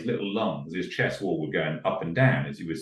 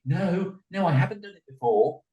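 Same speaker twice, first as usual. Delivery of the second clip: put-on child's denial; 11 semitones higher than usual. Child answers the praise.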